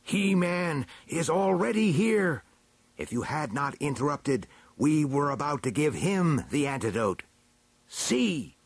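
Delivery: high-pitched, stately elder voice; indignant chief elder. A narrator's reading. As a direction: pronounce it grandly, rising to a cry. Elder denounces the supposed impostor.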